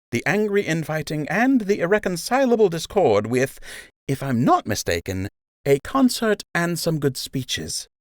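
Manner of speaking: mock the big meeting lightly; wry nurse Emma. Emma mocks the peace meeting.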